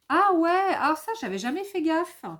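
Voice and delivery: sudden high, loud ah ouais, then quick; a real little jolt of discovery; surprised realization. Real, not acted. Vlogger notices both boxes share one reference, surprised.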